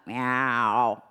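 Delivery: low chest meow to contrast. Shown as a demonstration. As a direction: meow sung low, below the pencil. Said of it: "That feels like below the pencil".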